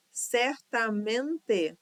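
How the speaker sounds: "Certamente" slowly as cer-ta-men-te; syllable by syllable. Shown as a demonstration. Teacher checking syllable division, "Certamente" syllable by syllable. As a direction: sound out "Certamente" with a full final E. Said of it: "divisão silábica de todas as palavras".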